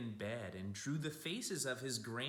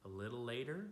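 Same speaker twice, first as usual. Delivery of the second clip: gentle picture-book storytelling; storybook voice for kids; reading to children. A narrator's reading. Reads picture book aloud.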